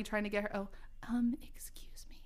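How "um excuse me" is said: soft whispered excuse me; quiet. Acted. Reading her story aloud, redoing the line as a shy whisper. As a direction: redo it as a timid whisper. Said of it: "I said quietly".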